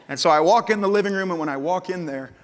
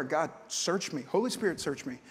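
hushed prayer; 2 semitones lower, 8 dB quieter than usual. Retold or imitated. Habitual morning prayer.

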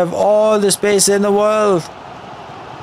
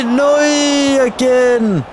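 dismayed no; 4 semitones higher, slower than usual. Real, not acted. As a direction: cry out as the chance dies. Shot denied in the game.

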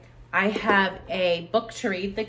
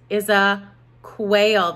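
slow reveal; 6 dB louder, much slower than usual. Shown as a demonstration. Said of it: "slow reveal".

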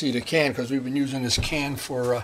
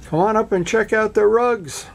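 mimicking a higher-pitched call down the stairs; imitated yell downstairs; 8 semitones higher than usual. Karen calling the speaker up to see rugs, imitated yell. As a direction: call out, raised in pitch, like someone summoning from upstairs. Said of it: "Karen just yelled down at me".

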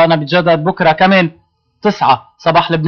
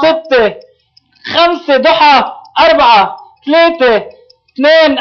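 excited countdown; 8 semitones higher, slower than usual. Real, not acted. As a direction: count down with building urgency. Counting down the seconds.

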